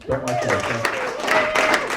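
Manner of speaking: very high, very slow drawn-out complaint; high mock whine. Comic mocking his own disliked intro, high and slow. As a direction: stretch it into a squeaky wail.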